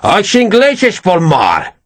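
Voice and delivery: slow, high-pitched Portuguese outburst; shout. Acted. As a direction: spit the words out at Perez, drawn out. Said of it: "he shouted at Perez".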